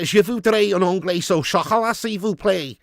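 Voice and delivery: jokey Scouse French; Scouse-accented French. Acted. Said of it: "French in a Scouser's accent".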